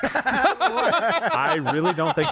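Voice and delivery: high, slow, amused protest through laughter; laughing. Real, not acted. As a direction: talk while cracking up. Laughs at a silly sound.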